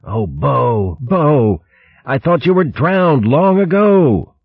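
cling to the friend you thought lost; choked-up joyful relief; tearful. Acted. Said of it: "weeping unashamedly".

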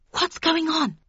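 high, anxious hissed question; urgent hiss. A narrator's reading. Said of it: "I hissed".

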